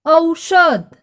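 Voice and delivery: औषध said clearly for the class; model word. Shown as a demonstration. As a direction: show how औषध sounds. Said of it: "आपको भी करना है उच्चारण".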